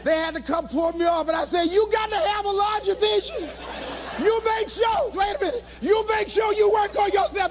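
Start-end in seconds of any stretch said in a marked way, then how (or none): 1.7-7.5 high, overwrought exhortation; frantic mock anguish. Dumped motivator clings to the stage, high and distraught. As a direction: keep preaching while being pulled away. Heartbroken, won't leave the stage.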